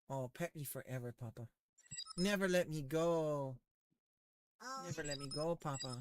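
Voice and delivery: soft, lightly voiced dog; pug voice. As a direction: speak for the pug, sweetly. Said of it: "he says".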